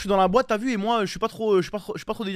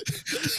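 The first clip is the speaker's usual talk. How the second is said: quieter high-pitched laugh; 5 dB quieter than usual. Real, not acted. Streamer recounting his beating to friends, laughing high. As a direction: break into a high giggle.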